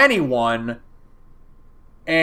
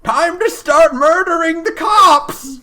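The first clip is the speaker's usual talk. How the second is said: high, slow hammed-up granny; feisty old lady; 12 semitones higher, 5 dB louder, slower than usual. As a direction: cackle it out like a gleeful granny. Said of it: "an old lady who gets up one day and she's like".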